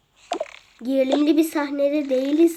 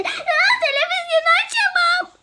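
laughing line; 13 semitones higher, faster than usual. Acted. Repeats line, laughing high.

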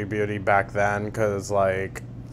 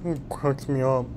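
higher-pitched mimicry; mocking echo; 5 semitones higher than usual. Reactor parrots the streamer's amusement, mocking. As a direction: parrot it back in a high mocking voice.

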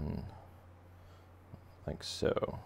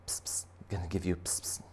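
whispered hissy spray noises; whispered spritzing; 7 dB quieter than usual. Acted. Cleaner fish grooming the angelfish, whispered spritzing. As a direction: whisper it between little psst sounds.

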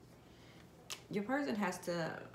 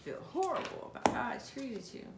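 voiced regret; 6 semitones higher, 3 dB louder than usual. Voicing masculine's regret.

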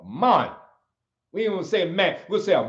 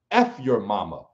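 much lower, imitating his mentor; mentor's gruff line; 10 semitones lower than usual. Mentor quoted flatly telling him off, low voice. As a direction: drop deep for the old coach. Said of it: "he looked me in my eye and he said".

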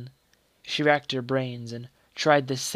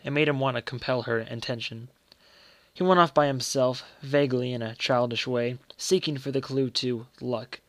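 ordinary delivery, nothing marked

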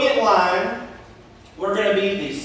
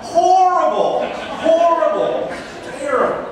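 emphatic disgust; 10 semitones higher than usual. Real, not acted. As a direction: spit out the horror.

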